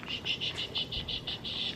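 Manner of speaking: soft unvoiced shushing. Real, not acted. Soothing baby to sleep.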